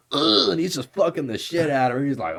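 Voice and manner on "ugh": 1 mock grunt. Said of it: "he's just like".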